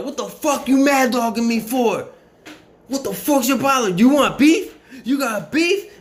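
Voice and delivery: high, loud mocking challenge; aggressive shout. Staff member picking a fight with the kid. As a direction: hammer him with threats. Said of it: "He said, uh".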